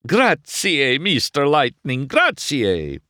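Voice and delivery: thankful Italian shopkeeper, high and bright; Italian accent. Acted. Luigi thanks McQueen, gushing Italian accent.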